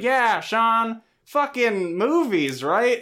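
eager Pete. Retold or imitated. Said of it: "Pete kind of is like".

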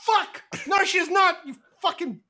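high, loud catty refusal; bullied girl yelling back at slapper; indignant shrieking. Acted. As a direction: shriek your defiance, then give in. Slapped character refusing, then cracking.

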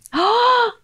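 loud sharp surprised gasp; real startled intake of breath; shocked gasp. Real, not acted. Shocked by the K thing.

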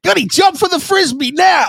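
very high, hammed-up calling voice; mock command. Co-host pretending to command Gunny, high mock call. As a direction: call out a trick in a high put-on voice.

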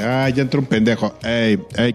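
disguised deep voice, sneering at a newbie; snooty gringo gamer. Retold or imitated. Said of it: "la actitud gringa".

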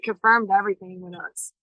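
normal talking voice

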